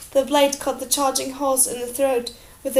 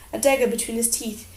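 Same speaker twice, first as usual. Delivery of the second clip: ordinary delivery, nothing marked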